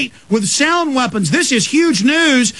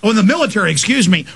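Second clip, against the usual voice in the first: ordinary talk, nothing marked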